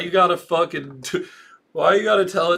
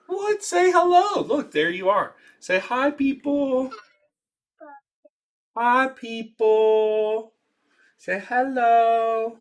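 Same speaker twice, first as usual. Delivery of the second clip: higher sing-song parent voice; 6 semitones higher, slower than usual. Real, not acted. Parent coaxes kid to greet the stream, high sing-song. Coaxing small child to greet viewers.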